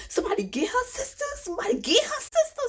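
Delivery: high, fast urgent order; urgent nurse. Retold or imitated. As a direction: hurry the staff along in a mock nurse voice. Nurse calls urgently for sister.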